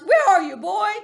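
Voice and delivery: loud, high father's shout. Acted. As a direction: bellow for a missing boy. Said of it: "and he shouts".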